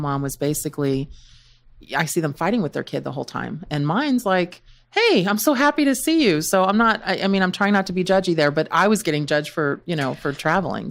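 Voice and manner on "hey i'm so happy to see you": high-pitched imitation of a glad kid; happy child greeting. Daughter glad to see her mom back, imitated high and happy.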